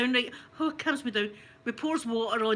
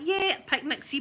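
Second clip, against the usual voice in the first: ordinary talk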